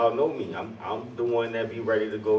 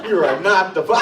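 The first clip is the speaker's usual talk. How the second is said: loud, higher mock announcer call; TV paternity reveal; 6 semitones higher, 7 dB louder than usual. Friend re-enacting his dramatic run backstage, mock announcing loudly. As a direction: call out the verdict like a talk-show host. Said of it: "that was me. That's how I ran".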